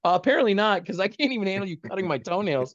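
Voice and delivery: high, loud comic protest; retold flustered outburst; exasperated retort. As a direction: act out losing your composure. Acted panicked retort to trainer.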